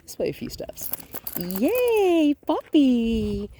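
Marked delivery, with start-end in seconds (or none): 1.4-3.5 high, loud, delighted cheer; happy voice. Shown as a demonstration. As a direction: cheer the puppy on like it's a party. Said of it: "Happy voice".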